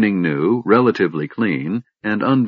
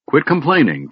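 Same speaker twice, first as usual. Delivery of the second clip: snapping; 6 semitones higher than usual. A narrator's reading. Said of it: "Tanis snapped".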